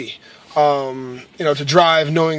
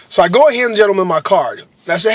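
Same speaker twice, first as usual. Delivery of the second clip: usual speaking voice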